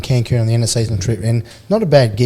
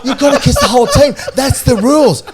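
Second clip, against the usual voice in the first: higher, louder singsong claim of the rules; cheeky sing-song; 12 semitones higher, 8 dB louder than usual. Retold or imitated. Retelling how he told Sandra the team rule, high sing-song. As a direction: chant the made-up rule with glee.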